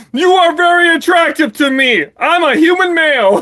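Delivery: loud, high mock flirt; impression of Joseph. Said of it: "That's my impression of Joseph".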